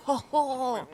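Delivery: hammed-up gloating with a laugh; mock villain. Player gloating over a found gnome, mock villain. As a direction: play the smug villain.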